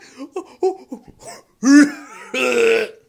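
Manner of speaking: big loud wheezing laugh; loud laughing fit. Real, not acted. Vlogger cracking up at his own squeal, loud laugh. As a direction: crack up helplessly at yourself.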